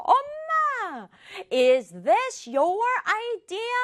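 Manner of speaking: high, slow challenge to Mom; accusing. Acted. Child rushing to Mom in the kitchen, accusing question. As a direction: put the question to Mom as a high-pitched protest. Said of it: "약간 따지는 말투예요".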